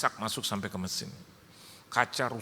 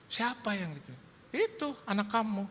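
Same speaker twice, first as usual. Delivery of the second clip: quoted exchange with wife; 12 semitones higher than usual. Retold or imitated. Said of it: "dia bilang".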